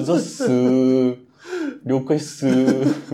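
mock slack greeting. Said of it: "ちょっとニヤッとしながら".

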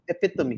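"epitome" said eh-PIT-uh-mee; corrected pronunciation. Shown as a demonstration. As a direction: give "epitome" four syllables, stress on PIT. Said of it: "somebody corrected me and said".

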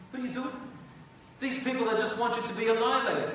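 high, urgent plea to stop him; alarmed warning. Acted. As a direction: warn him he is being fooled. Scholars warn the reckless king.

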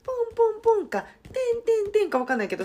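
high, loud sing-song beeps; beep imitation. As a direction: chirp the tones up high.

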